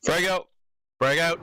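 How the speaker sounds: called out. Shown as a demonstration. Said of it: "demonstrate a safely thrown frag grenade".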